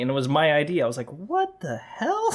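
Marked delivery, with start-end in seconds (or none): 1.1-2.4 high, slow, half-laughing what the hell; stunned laughing outrage; laughing disbelief. Retold or imitated. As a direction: crack up in disbelief. Outraged at stolen idea.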